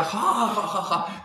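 high, gasping belly laugh; helpless mock guffaw; father's imitated guffaw. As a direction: imitate someone crying with laughter. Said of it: "le caían de la risa que tenía".

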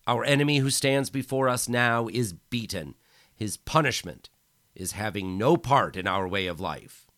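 loud, high triumphant proclamation; bellowing. Acted. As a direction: proclaim it over the crowd. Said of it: "Micheletti bellowed".

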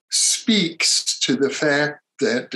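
ordinary talk

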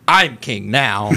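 slow, hammed-up royal declaration; mock proclamation. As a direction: draw it out like a self-crowned king. Acting out Bruce's announcement.

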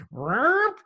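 a sudden pop of noise as the mind fills; whoop sound. Retold or imitated. Brain filling with thoughts.

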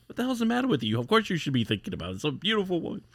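high, incredulous scolding of the retainer; mock exasperated outburst. Lord scolding the Ronin for not wanting the woman, high exasperated. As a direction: throw up your hands in mock disbelief. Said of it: "He goes".

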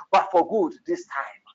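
normal talking voice

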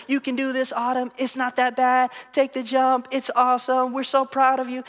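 high, bright chorus of encouragement; friends encouraging. Retold or imitated. Friends in the water urging Autumn to jump, high cheering. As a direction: coax her down like cheering kids. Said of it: "watching her friends encourage her".

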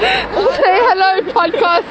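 high, slow, wailing greeting; high silly voice. Pretend. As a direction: stretch it out in a mock whine. Friends greeting the podcast.

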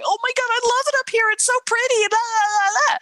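gushing high and fast; squealing fast delight over the view; excited guests. Retold or imitated. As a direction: rattle it off like a thrilled visitor. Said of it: "the excitement of the people going".

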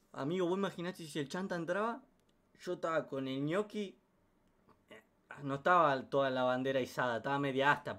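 ordinary talk

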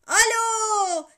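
high, very loud hello; boy yelling into the receiver; shouting. A narrator's reading. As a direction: bellow it down the phone. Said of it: "diye bağırıp telefonu kapattı".

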